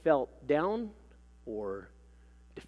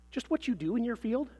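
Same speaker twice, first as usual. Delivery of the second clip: higher-pitched, curious question; new interest; 8 semitones higher than usual. A narrator's reading. Clerk asks what her field involves, with new interest. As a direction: inquire with freshly piqued interest. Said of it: "with new interest".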